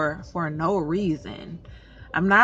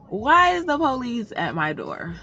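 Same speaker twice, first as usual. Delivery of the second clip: usual speaking voice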